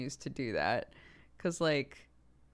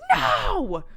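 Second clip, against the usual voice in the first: sharp, much louder shouted no; snapped no; 2 semitones higher, 11 dB louder than usual. Retold or imitated. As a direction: imitate the toddler snapping back. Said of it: "She just fucking snapped".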